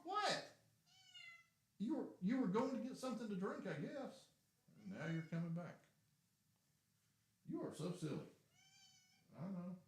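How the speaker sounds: high, playful pet voice. Real, not acted. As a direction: tease the cat fondly. Chatting to the cat.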